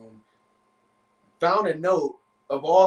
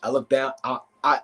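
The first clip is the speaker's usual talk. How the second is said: ordinary delivery, nothing marked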